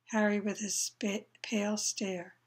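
usual speaking voice

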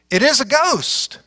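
a touch of scared disciples; frightened cry. A narrator's reading. Disciples seeing Jesus on the sea, mildly frightened. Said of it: "And they cried out for fear".

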